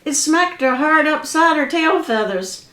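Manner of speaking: ordinary delivery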